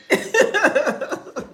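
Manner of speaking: high, trailing peal of laughter; real laughter. Real, not acted. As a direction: crack up after your own remark. Laughs after denying she talks loudly.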